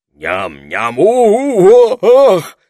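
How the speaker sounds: from munching into a loud, high howl; munching turns to howl. A narrator's reading. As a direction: break off into a yowl as if struck. Oswald hit mid-munch.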